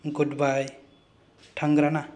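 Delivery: ordinary talk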